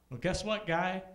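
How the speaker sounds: ordinary talk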